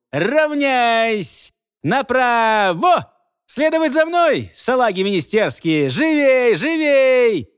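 iron-voiced drill commands, high and loud; stiff barking toy soldier. A narrator's reading. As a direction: bark marching orders at the recruits. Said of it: "практически железным голосом отчеканил".

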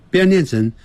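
ordinary talk

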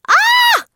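sharp, very high scream. A narrator's reading. Dory stung by the jellyfish, screaming. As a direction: scream high and loud in pain. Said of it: "فصرخت".

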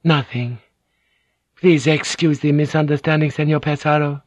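forced politeness. A narrator's reading. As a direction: sound polite through the tension. Mariko's voice.

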